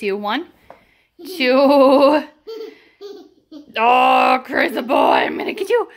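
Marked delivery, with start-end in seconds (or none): 4.9-5.9 playful monster growl. Pretend. Playful chase with toddler.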